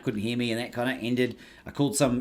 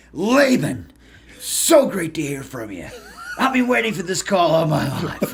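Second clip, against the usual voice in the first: enthusiastic greeting; 6 semitones higher, 6 dB louder than usual. Retold or imitated. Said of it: "He goes".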